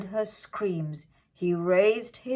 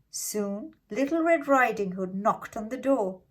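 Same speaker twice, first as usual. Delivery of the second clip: ordinary delivery, nothing marked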